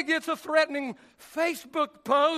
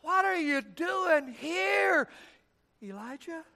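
slow, pointed rebuke, softer; God gently rebuking His hiding prophet, slowly; 4 dB quieter, slower than usual. Acted. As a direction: drag out the words like a disappointed God. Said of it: "Notice the rebuke".

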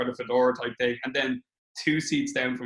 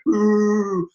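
mock crowd ooh; 5 semitones higher, 7 dB louder than usual. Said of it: "hear people going like".

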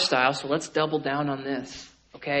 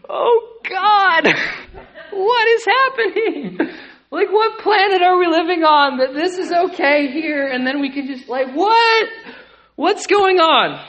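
high, loud incredulous squeal with a laugh; shocked disbelief; 11 dB louder, slower than usual. Retold or imitated. Preacher reliving his shock in the front row, squealing disbelief. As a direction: replay the horror of hearing him preach. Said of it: "And I'm on the front row like".

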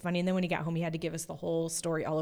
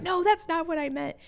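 much higher protesting correction; a lightly mocking whiny dad; flustered husband; 10 semitones higher, 3 dB louder than usual. Husband correcting his wife's teasing, mock whiny protest. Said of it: "He's like".

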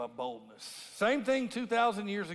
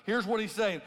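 normal talking voice

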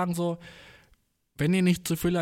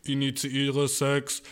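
very slow mechanical monotone; slow droning robot command; much slower than usual. Retold or imitated. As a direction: announce it like a machine. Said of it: "seid ihr beides Roboter?".

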